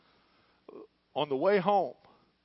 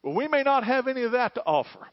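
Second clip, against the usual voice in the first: loud, high preaching climax; full-force pulpit shout; fiery preaching; 9 semitones higher, 6 dB louder, faster than usual. Acted. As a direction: lift the crowd, preaching hard.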